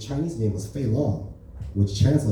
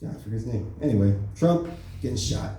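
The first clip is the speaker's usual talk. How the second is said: ordinary talk, nothing marked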